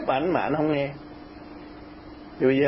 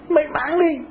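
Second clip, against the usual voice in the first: higher-pitched, insistent retold order; retold urging; 11 semitones higher than usual. Telling the factory owner to sell, insistent. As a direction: press the point as a quoted order.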